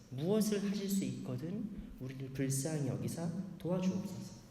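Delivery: hushed, pleading prayer of a desperate father; a touch of a father begging. Retold or imitated. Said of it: "예수님께 이렇게 요청하는 겁니다".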